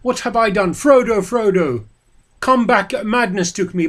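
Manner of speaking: loud, high desperate calls; shouting in remorse. A narrator's reading. Boromir calling after Frodo, desperate shout. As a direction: call him back in anguish. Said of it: "he shouted".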